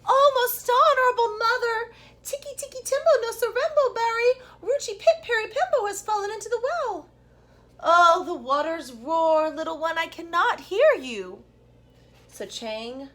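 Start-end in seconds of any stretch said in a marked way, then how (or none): 0.1-7.1 put-on much higher, louder little boy calling; a small boy pleading with his mother; breathless little boy's plea; high child's voice. A narrator's reading. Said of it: "to his mother and said". 7.8-11.4 slow, higher, louder mother over the water; mother straining to hear her son; mother's voice. A narrator's reading. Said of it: "said his mother".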